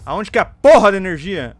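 loud annoyed outburst; frustrated yell. Real, not acted. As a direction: yell it, fed up. Frustrated in game.

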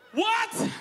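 high, stunned disbelief; shocked what. Real, not acted. Comic hearing the man's news, high shocked yelp.

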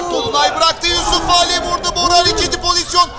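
high, loud rapid call; excited commentator. Real, not acted. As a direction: call the chance with rising urgency. Late chance, commentator excited.

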